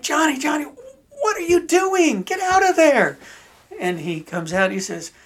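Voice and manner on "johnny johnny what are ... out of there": high, loud mock parental yell, stretched out; alarmed calling out. Parents finding their son tunneled in manure, alarmed yell. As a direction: call down to a child in exasperated disbelief.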